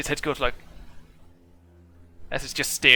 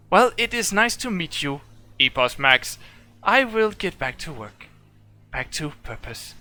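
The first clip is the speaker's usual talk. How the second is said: high, formal machine farewell; polite robot; 5 semitones higher than usual. Acted. Warforged saying goodbye to Epos Max, high robotic voice. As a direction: sign off and return to duty.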